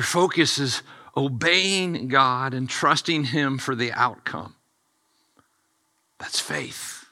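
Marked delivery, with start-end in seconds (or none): 6.2-7.1 whisper. Real, not acted. Pastor's closing point.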